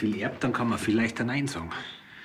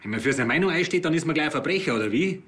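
raised, higher-pitched indignant retort; indignant protest; 7 semitones higher, 5 dB louder than usual. Acted. Suspect hits back at police for treating him as a criminal, indignant. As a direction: protest loudly, pitched up, affronted at the suspicion.

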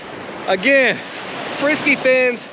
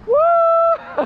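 excited whoop. Real, not acted. Big pompano caught, whooping.

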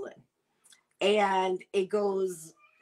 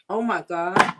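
delighted exclamation; 6 dB louder than usual. Real, not acted. Tasting the clam, delighted.

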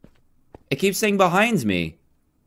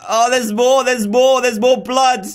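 high, alarmed repetition; 6 semitones higher, 3 dB louder than usual. Real, not acted. Player spots blood in the basement, alarmed. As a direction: voice jumps up in dread.

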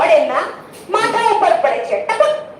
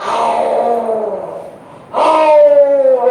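lion roar; 5 semitones lower, much slower than usual. Acted. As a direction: roar like the jungle king for children. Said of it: "ગર્જના કરતો એ સિંહ આવે છે". (સિંહની ગર્જના)

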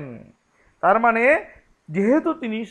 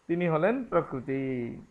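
usual speaking voice

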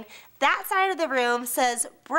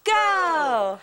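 cheer call; 4 semitones higher, 3 dB louder than usual. Real, not acted. Leading a crowd cheer.

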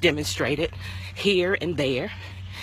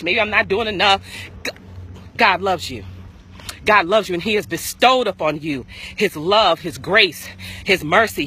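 preaching; 4 semitones higher than usual. Acted. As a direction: preach it with full force, rising. Preaching at full force.

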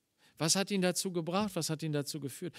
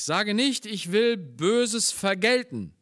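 1 emphatic scripture reading; 6 semitones higher, 9 dB louder, slower than usual. Acted. Reads scripture in preaching voice.

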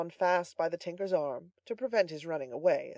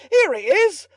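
loud, high shout of triumph; excited bawl; 13 semitones higher, 15 dB louder than usual. A narrator's reading. Butler announcing his captive to the house, excited bawling. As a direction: bawl it up the staircase. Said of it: "bawled Giles".

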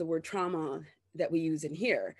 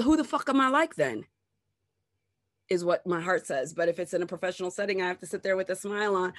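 ordinary talk, nothing marked

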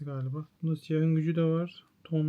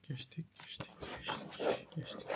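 hushed, whispered muttering; barely voiced, talking to yourself; whispering to self; 10 dB quieter than usual. Real, not acted. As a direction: whisper it absently while skimming pages. Skimming pages, muttering to self.

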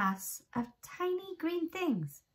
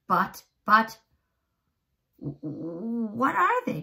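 frightened stammer; 3 semitones higher, 4 dB louder than usual. Acted. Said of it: "James muttered".

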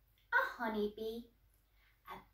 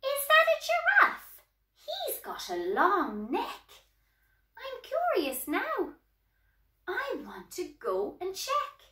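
curious character; 6 semitones higher than usual. A narrator's reading. Curious elephant character asks about animals.

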